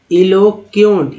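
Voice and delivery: "इलोक्यूंट" slowly as i-lo-kyont; slow, separated "इलोक्यूंट"; model pronunciation. Shown as a demonstration. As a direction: teach "इलोक्यूंट" piece by piece. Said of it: "ई एन टी को एंट बोलते हैं".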